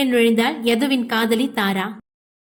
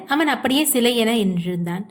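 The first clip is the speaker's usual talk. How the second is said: usual speaking voice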